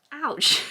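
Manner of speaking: sharp real pain, loud; loud ouch. Real, not acted. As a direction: yelp it out in pain. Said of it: "split my lip".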